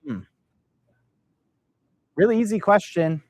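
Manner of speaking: ordinary talk, nothing marked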